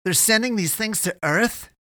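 high, loud, incredulous question; crying out in alarm. A narrator's reading. Eddie realises where the creatures go, crying out. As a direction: exclaim the question in horrified disbelief. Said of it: "he cried".

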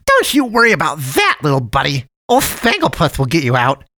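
sugary high cartoon voice; jolly cat man. A narrator's reading. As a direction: play the friendly dump cat, overly sweet. Cat man reassures boy.

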